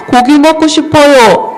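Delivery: put-on high, loud childlike demand for meat; put-on high, insistent plea; pleading Israelite. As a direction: call it out like a hungry complainer. Israelites voiced begging for meat.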